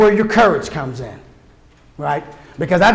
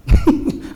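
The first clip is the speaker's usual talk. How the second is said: high laugh. Real, not acted. Laughs at his own point.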